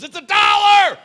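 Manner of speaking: high, loud yell from the back; mock shout. Stock room kid yelling back to the cashier, high loud shout.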